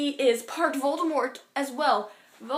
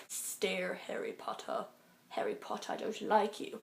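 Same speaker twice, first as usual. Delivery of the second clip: hushed, breathy sneer, softer throughout; Snape impression; 5 semitones lower, 11 dB quieter than usual. Vlogger mocks Snape staring down Harry, in a hushed sneer. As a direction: imitate a cold teacher glaring down at a boy.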